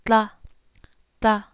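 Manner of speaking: "Tla" tongue tip on the ridge, sides on the side teeth; correct tla. Shown as a demonstration. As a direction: subtle "tla", no clucking. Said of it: "sides of your tongue relaxed".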